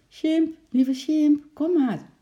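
high, drawn-out coaxing call. A narrator's reading. Girl luring her ape with the squirrel, coaxing call. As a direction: call him over in long, high notes. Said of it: "riep Lotje zacht".

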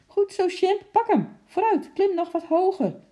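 high, loud, excited urging; cheering. A narrator's reading. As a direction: cheer the climber on. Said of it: "riep Henkie juichend". (juichend aanmoedigend)

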